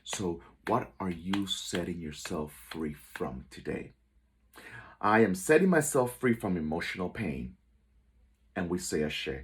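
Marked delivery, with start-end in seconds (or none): 5.0-7.5 declaration with conviction. Shown as a demonstration. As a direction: proclaim it out loud with inner faith. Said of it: "say it with conviction".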